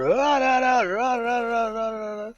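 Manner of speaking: sing-song mock tirade that trails off; mock nonstop yelling. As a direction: parody a man who won't stop shouting. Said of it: "he would just start".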